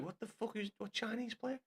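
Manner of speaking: mock bafflement at a misread name; baffled disbelief. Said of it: "I was like".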